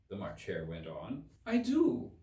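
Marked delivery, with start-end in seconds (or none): 1.4-2.1 hasty. A narrator's reading. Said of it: "Alice hastily replied".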